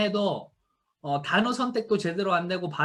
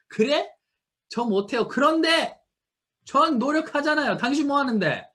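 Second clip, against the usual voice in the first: bold, louder comeback, head held high; confident retort; 8 semitones higher, 4 dB louder than usual. Shown as a demonstration. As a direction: answer back proudly and loud. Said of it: "이렇게 말을 하면서 당당하게". (당당하게 크게 받아치는 말투)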